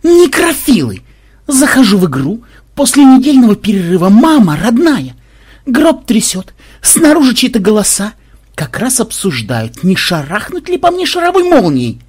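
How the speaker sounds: high, loud, hammed-up tirade; outraged yelling. A narrator's reading. Ксен recounts waking in a shaking coffin, indignant yelling. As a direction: wail the grievance at full pitch. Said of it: "завопил в ответ Ксен".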